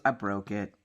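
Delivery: a hint of a sheepish child husband; childlike confession. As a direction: mumble it like a guilty kid. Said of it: "acts like a tiny child".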